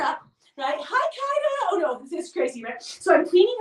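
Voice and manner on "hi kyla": very high sing-song puppy greeting; cooing hello to the new puppy. Real, not acted.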